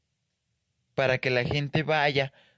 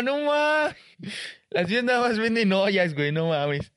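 much higher, slow, astonished gasping voice; first-timer amazement; 7 semitones higher, slower than usual. Retold or imitated. First-time visitor looking up at the shops, astonished. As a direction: marvel at every shop as if you've never seen one. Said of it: "voltea para todos lados así".

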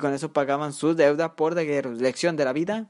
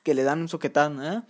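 normal talking voice

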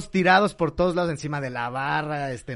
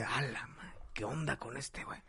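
much softer bar crowd murmuring; muttering; 3 semitones lower, 16 dB quieter than usual. Acted. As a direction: mutter it under your breath. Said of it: "todos en el bar así de".

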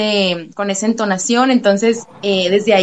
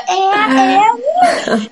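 high-pitched "ea, ea" call; signature radio shout; 9 semitones higher, slower than usual. Shown as a demonstration. Host recalls the song launching her into the show, high signature cry. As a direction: launch the catchcry high over the music. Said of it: "Este grito fue surgiendo solo".

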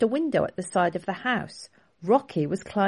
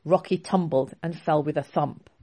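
ordinary delivery

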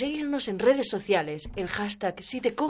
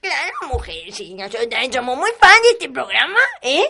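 put-on gossipy old lady; 2 semitones higher, 6 dB louder than usual. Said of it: "son Florinda y Natividad".

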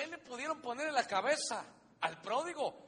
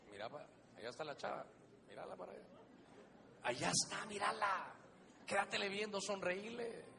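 tempting enemy voice; 4 dB quieter than usual. Acted. Voicing the enemy tempting a man.

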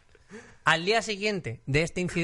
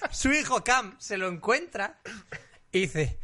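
ordinary delivery

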